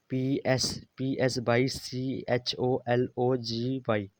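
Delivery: very slow letter-by-letter spelling; spelled out. Shown as a demonstration. Teacher spells a silent-letter word for students, very slowly by letters. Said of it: "पी जो है यहाँ silent है".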